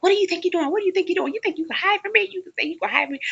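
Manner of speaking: high, harsh, accusing voice; mock furious attacker. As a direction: corner her and demand answers. Attacker catches her hiding.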